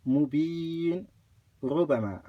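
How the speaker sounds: "mubin" stopped, then a single short R on "rubama"; no shadda. Shown as a demonstration. Teacher giving example, "mubin", break, "rubama" undoubled. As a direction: pause after "mubin", begin "rubama" without doubling. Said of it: "no shadda".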